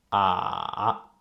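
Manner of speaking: long "ah" dipping down, then rising; falling-rising tone. Shown as a demonstration. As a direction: let "ah" sink first and then climb. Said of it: "It goes down first".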